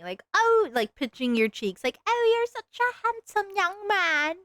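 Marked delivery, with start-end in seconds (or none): 0.3-0.7 high cooing "Oh" like a granny; old lady coo. Retold or imitated. Streamer imitating an old lady pinching cheeks, high coo. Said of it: "think of it like an old lady". 2.1-4.4 high, slow, fond granny voice; doting old lady. Retold or imitated. Streamer shows how she calls people handsome, high slow granny voice. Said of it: "think of it like an old lady".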